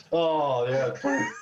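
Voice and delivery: normal talking voice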